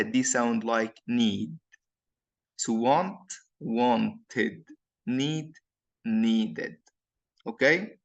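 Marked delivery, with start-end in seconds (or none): 3.6-4.6 "wanted" in two clear syllables, "want-id"; final ED as ID. Shown as a demonstration. Teacher showing ED after T, "wanted" with ID ending. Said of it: "إمتى أنطق ال final E D as id". 6.0-6.8 "needed" in two clear syllables, "need-id"; final ED as ID. Shown as a demonstration. Teacher showing ED after D, "needed" with ID ending. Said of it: "إمتى أنطق ال final E D as id".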